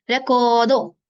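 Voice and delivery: रेकॉर्डो with a loud, held को; long vowel stressed. Shown as a demonstration. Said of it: "को की long sound हो जाएगी". (रेकॉर्डो, को पर ज़ोर)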